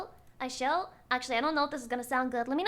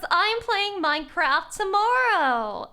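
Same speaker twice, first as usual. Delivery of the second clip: excited; 3 semitones higher, 5 dB louder, slower than usual. Acted. Loud upbeat reassurance.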